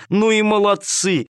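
high, slow delighted praise; joyful praise. Acted. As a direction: praise the kittens, overjoyed. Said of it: "повторяла она".

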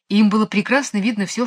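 usual speaking voice

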